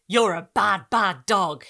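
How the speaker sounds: loud mock scolding little girl; child scolding dog. A narrator's reading. Girl playfully scolding the dog, loud. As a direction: wag a finger at the dog.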